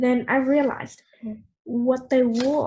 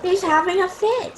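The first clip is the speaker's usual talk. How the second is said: alarmed; 7 semitones higher, 5 dB louder than usual. Acted. Uncle Bill alarmed.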